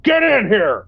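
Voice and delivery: mock yell. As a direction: bark the order like a fed-up boss. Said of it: "they're like".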